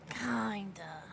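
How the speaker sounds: whispered aside; whispered doubt about the dog. Real, not acted. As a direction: breathe it as a quiet aside. Aside about dog being good.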